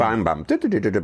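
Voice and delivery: imitated thuds. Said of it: "imitated exhaustion noises".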